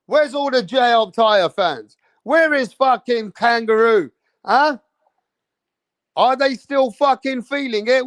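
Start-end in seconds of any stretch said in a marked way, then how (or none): none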